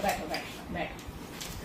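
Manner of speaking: whispered, almost no voice; whispered sit. Real, not acted. Mother whispering to her daughter to sit. Settling the child down.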